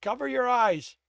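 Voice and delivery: loud, high yell; yelled warning. A narrator's reading. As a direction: shout a warning to the team. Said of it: "Tibbs yelled".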